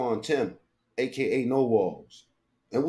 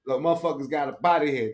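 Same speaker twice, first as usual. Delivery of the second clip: usual speaking voice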